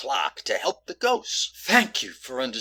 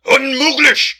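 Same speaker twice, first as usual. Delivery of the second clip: loud, high German outburst; German exclamation; 12 semitones higher, 11 dB louder, slower than usual. Acted. Officer translating for the detective, emphatic German. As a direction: shout it out in German. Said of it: "How do they say it in German".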